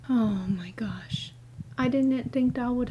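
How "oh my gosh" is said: breathless frightened whisper; scared whisper. Real, not acted. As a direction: whisper it in shock.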